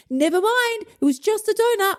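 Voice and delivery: high, singsong mock cheer; breezy dismissal. Imagined dieter shrugging off a donut, high breezy cheer. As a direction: toss it off in a high lilt. Acted carefree response nobody gives.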